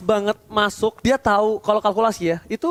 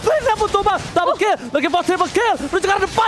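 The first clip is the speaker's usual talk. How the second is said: fighting game announcer; 11 semitones higher, 3 dB louder than usual. Real, not acted. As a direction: hype building to shouted climax, much higher. Peak of live teamfight commentary.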